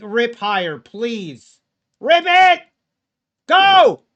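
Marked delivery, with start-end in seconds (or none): 2.0-4.0 high, loud cheering; frantic cheer for the price; excited shout. Real, not acted. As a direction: yell it on like a race. Cheering market upward.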